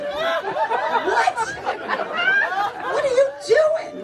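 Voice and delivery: very high, squealed mock disbelief; incredulous shock. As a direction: act baffled, pitch shooting way up. Acted shock at doctor in bed.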